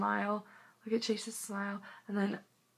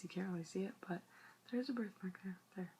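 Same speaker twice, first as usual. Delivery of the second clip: whisper; 12 dB quieter, faster than usual. Real, not acted. Pointing out birthmark quietly.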